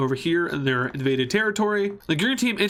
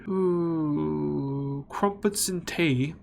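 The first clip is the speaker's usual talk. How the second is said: slow, drawn-out mock British voice; hammed-up British accent; 4 dB quieter, much slower than usual. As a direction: stretch it out like a caricature Brit. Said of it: "Here's the British voice".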